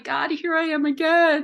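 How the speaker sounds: a fed-up here-we-go-again to God; weary plea to God. Retold or imitated. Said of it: "it's like".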